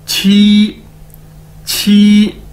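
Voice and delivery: छी said loud and crisp; model pronunciation. Shown as a demonstration. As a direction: drill छी for Chinese qī. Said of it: "क्यूआई को कैसे pronounce करेंगे?".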